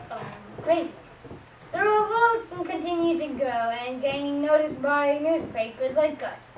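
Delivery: normal talking voice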